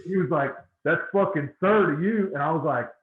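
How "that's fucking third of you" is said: mock-angry snap, louder and higher; heated retort. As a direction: replay the heated shouting match on the field. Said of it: "it just escalated like that instantly".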